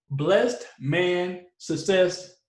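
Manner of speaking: spaced out word by word; slow and careful. Real, not acted. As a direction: take the name slowly, pausing between words. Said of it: "I had to say it slowly".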